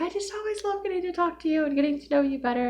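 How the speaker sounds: very high, sugary church-lady voice; high sugary imitation of a kind sister; sweet sister voice. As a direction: quote a gushing sister sweetly.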